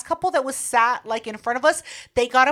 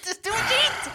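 squeaky anxious repeat, much higher; much higher nervous whine; higher whiny repeat; 8 semitones higher than usual. Retold or imitated. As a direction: whine it up high. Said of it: "I was just like".

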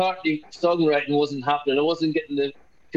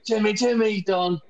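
"Jimmy Jimmy" sung high in Northern Irish accent; 6 semitones higher, slower than usual. Shown as a demonstration. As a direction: chant "Jimmy Jimmy" Belfast-style. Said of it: "you have to sing it in Northern Irish accent".

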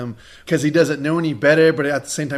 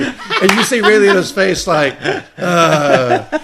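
laughing imitation; 4 semitones higher, 7 dB louder, much slower than usual. Imitating Ray Liotta's reaction.

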